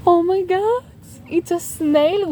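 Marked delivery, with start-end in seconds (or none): none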